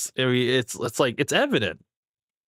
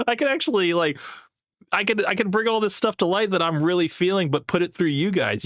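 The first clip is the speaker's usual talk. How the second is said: mock-grateful higher voice; lightly hammed-up, higher-pitched imitation; imitating Myron; 6 semitones higher than usual. Host voicing Myron thanking the haters, imitation. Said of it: "He's like".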